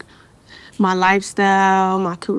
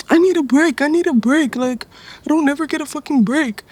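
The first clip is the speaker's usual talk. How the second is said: higher-pitched mock whine for a break; pitched-up imitation of complaining; exasperated complaint; 6 semitones higher than usual. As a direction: retell it as an acted, fed-up plea. Acted retelling of own complaint.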